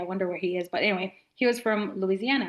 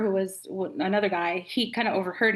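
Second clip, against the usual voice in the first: usual speaking voice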